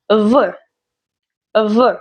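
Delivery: buzzed "vuh" for V; V as a sound. Shown as a demonstration. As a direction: say V as in van, not its name. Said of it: "like in van".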